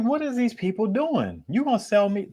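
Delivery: high-pitched, exasperated mock questioning; incredulous. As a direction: squeak it out in disbelief. Acts out own disbelief at Sony.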